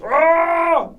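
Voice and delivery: long, high-pitched yell. Shown as a demonstration. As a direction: let out a drawn-out high yell. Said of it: "I'm yelling like [name] and shit".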